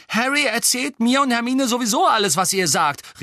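high, loud, upset protest; worked-up shout. A narrator's reading. Ron objecting to being sent away, worked-up cry. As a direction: burst out in indignation, pitched high. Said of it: "rief Ron aufgebracht".

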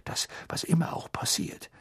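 breathy, soft urgency; urgent half-whisper; hurried. A narrator's reading. Father pleading before the train leaves, hushed urgency. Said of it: "sagte Mister Weasley noch hastiger".

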